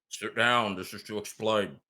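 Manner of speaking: mumbled words from behind a scarf; muttering figure. Acted. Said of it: "you hear him mutter out".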